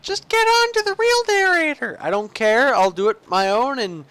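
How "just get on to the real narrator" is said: very high-pitched mock viewer whine; mock complaining viewer. Imitating viewers who want the real narrator, high mock complaint. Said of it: "all you who are like".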